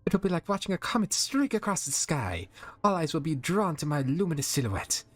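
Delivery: put-on high, airy French accent diva; glamorous supermodel gushing.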